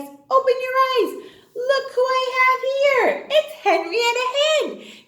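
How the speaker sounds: put-on high, loud, excited children's presenter; excited reveal. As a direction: unveil it with big high-pitched delight.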